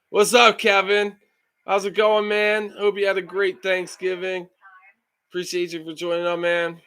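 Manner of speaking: high, loud cheery hello; light up for a viewer; upbeat greeting. Real, not acted. Streamer greeting a chat viewer, high and bright.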